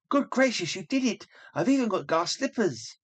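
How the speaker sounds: put-on a girl stunned by her new gown; amazed delight. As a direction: gasp at the glass slippers. Lois amazed at transformation.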